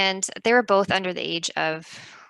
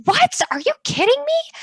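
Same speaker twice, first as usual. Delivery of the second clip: much higher, louder shrieking disbelief; shocked disbelief; 5 dB louder than usual. Retold or imitated. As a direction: hit it like the worst news of the day. Reacting to dog poop news.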